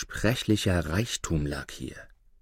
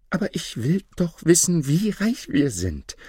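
high, insistent wife's exclamation; eager wife; 8 semitones higher than usual. A narrator's reading. Wife wants to know their riches, eager and insistent. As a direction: insist eagerly, stretching the end. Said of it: "rief seine Frau".

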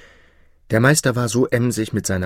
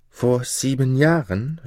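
higher, slow rising disbelief; shocked echo; 6 semitones higher, slower than usual. A narrator's reading. Jakob echoes his father, shocked and slow. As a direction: repeat it as the truth sinks in. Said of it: "rief Jakob mit Entsetzen".

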